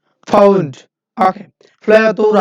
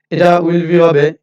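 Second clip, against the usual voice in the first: normal talking voice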